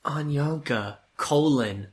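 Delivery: ordinary delivery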